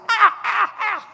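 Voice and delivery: shrill high cries of laughter; high laughing scream. Retold or imitated. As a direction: let the laughter pitch up high. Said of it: "started to laugh, this".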